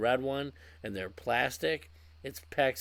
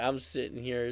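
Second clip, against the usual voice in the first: normal talking voice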